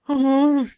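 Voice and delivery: loud, high labour moan; pained groan. A narrator's reading. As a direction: moan it loudly through the pain. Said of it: "простонала Черити". (громкий высокий стон)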